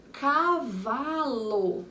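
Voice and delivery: "cavalo" slowly drawn out; long, measured "cavalo" for counting; stretched-out syllables. Shown as a demonstration. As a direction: linger on each syllable of "cavalo". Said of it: "Três sílabas".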